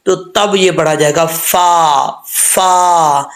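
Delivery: फा said with rounded lips, drawn out. Shown as a demonstration. Teacher demonstrating rounded फा to students. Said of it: "फा, होंठ गोल करके".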